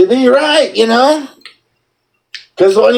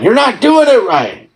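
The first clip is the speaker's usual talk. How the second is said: mock scolding; 5 semitones higher than usual. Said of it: "I've gotta chew out these loosh farmers".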